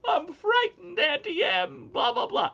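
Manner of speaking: high, loud mock wailing; shrill-pitched tearful movie impression; crying Dorothy. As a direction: imitate a frightened girl crying, hammed-up. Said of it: "when she's crying in The Wizard of Oz".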